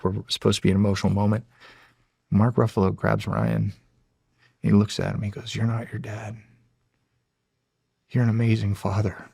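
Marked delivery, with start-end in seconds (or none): 5.5-9.3 soft, hushed quote; tender retold line. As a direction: say it quietly, like a father reassuring his son. Retold emotional scene between father and son.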